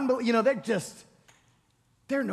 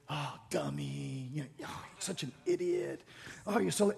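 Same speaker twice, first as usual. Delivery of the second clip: muttered under the breath, much lower and far softer; mock self-scolding; 7 semitones lower, 10 dB quieter than usual. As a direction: mumble insults at yourself, half to yourself. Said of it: "No more calling yourself names".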